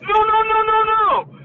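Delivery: hammed-up high, loud string of protests; panicked denial. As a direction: imitate a kid yelping in alarm, very high and loud. Retold kid's alarmed denial.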